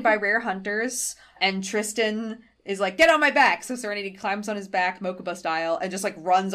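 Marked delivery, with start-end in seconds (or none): 3.0-3.6 raised, louder quick order; urgent command. Retold or imitated. Tristan telling Serenity to climb on, urgent command. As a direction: bark out a retold urgent command.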